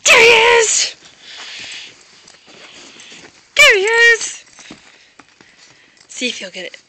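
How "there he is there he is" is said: thrilled high squeal of discovery, much louder; excited. Real, not acted. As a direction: burst out delighted at finding him. Excited owner spots the dog.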